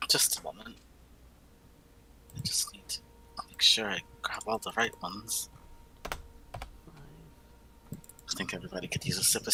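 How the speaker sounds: whispered, unvoiced and soft; hushed whisper while sorting potions. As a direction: murmur it under your breath. Player whispering in character.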